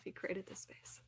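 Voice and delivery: usual speaking voice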